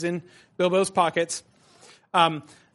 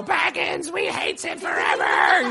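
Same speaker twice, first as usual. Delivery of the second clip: hammed-up creature voice dropping to a low shout; mock Gollum curse; 12 semitones lower, slower than usual. Teacher mocking the missing Gollum curse, hammed-up low shout. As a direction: snarl it like a vengeful creature. Mocks an imagined vengeful Gollum.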